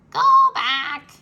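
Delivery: high, loud parrot call. A narrator's reading. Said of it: "said Polly".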